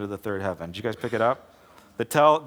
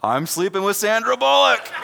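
high, loud bragging punchline, laughing; gleeful boast; 9 semitones higher, 6 dB louder than usual. Retold or imitated. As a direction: crow it like a proud buddy.

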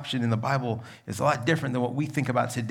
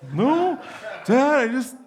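sheepish laughing denial; 11 semitones higher than usual. Retold or imitated. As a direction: protest to dad while laughing. Caught crying, denies it to dad.

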